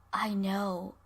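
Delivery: soft, low-voiced husky reply. Herb getting a little into it, husky. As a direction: answer huskily, drawn in despite yourself. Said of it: "says in a husky voice".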